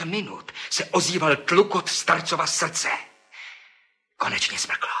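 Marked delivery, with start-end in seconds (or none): 4.2-4.9 breathless whisper, no voice. Acted. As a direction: whisper it into the silence. Šeptá ve vyprávění. (šeptem, bez hlasu)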